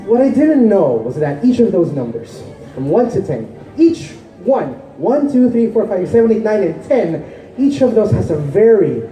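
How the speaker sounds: measured, explanatory poetry recital; spoken-word poem. Acted. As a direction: recite it like a stage poem, stressing key words.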